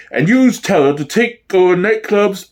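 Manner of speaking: ordinary delivery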